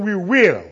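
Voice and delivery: "we will" punched loud; determined "we will". Shown as a demonstration. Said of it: "will shows emphasis and determination".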